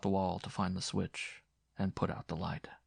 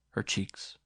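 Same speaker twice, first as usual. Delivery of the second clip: plain reading voice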